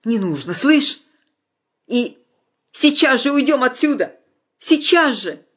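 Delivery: high, loud insisting; urgent demand. A narrator's reading. Anya urges Vasya to leave now, high and loud. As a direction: demand they leave at once.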